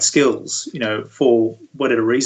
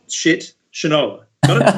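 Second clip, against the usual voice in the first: mock stern dad; 4 semitones higher, 4 dB louder than usual. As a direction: lightly play the dad drilling the lesson home. Dad lecturing son in film.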